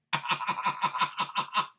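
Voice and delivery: long run of rapid laughs; staccato laughter; laughing. Real, not acted. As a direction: break into a rattling laugh. Laughs at his own remarks.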